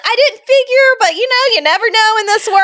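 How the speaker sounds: high, lilting put-on voice; imitated amused parent. Imitating a parent at the park humouring her, high-pitched. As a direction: pitch it way up, playfully conceding.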